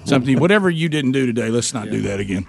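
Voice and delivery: low, clueless dad shrugging it off; playing dumb. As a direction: admit you have no idea. Father clueless, quoting mom.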